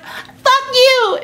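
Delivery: squeaky outraged mother voice; offended mom. Retold or imitated. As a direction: play the mom yelling back, sure she's being mocked. Mom again.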